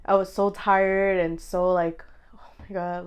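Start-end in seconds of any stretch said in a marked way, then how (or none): 2.4-3.1 soft, voiceless retold sigh; exhausted whisper. Mother recalling being so tired, exhausted whisper. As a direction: whisper it as if drained.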